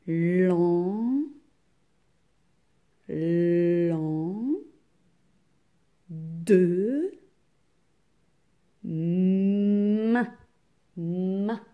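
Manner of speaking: lan, de, main each drawn out with pauses between; isolated syllables. Shown as a demonstration. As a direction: hold the first consonant of lan and main. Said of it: "Combien de syllabes dans lendemain?".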